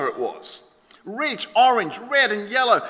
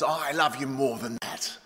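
softer, lower fatherly reassurance; tender dad; 6 semitones lower, 5 dB quieter than usual. Acted. Daddy answering his child's question, tender and low. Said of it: "Daddy says".

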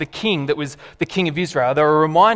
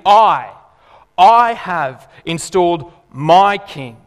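loud, slow, every word weighed; emphatic declaration; 7 dB louder, much slower than usual. Retold or imitated. God answering the rebelling nations, slow and emphatic. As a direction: plant each word like a royal decree. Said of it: "he says emphatically".

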